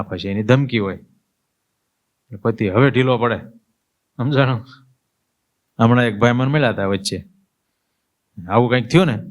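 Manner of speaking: normal talking voice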